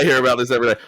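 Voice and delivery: playful voicing of a complaining pet; imagined dog's complaint. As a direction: pipe up loudly as the fed-up dog. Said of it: "He's like".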